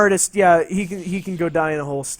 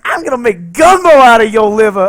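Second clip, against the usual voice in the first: louder menacing threat; threatening yell; 4 semitones higher, 11 dB louder than usual. Retold or imitated. The wife threatening to cook him, loud menacing growl. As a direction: bellow it like a crazed chef. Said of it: "She's like".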